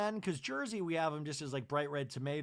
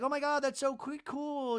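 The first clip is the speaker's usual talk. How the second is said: high gushing; 9 semitones higher than usual. Retold or imitated. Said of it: "they're like".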